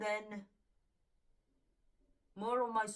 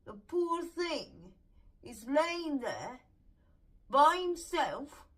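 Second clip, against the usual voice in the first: indignant pity; 5 semitones higher, 7 dB louder, slower than usual. Real, not acted.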